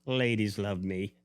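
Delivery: normal talking voice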